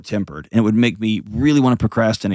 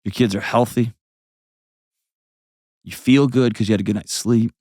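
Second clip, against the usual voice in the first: ordinary talk, nothing marked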